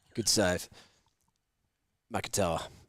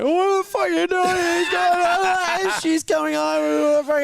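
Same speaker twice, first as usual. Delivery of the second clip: crying panic; 5 dB louder than usual. Retold or imitated. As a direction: sob it out in shock. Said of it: "I am actually crying".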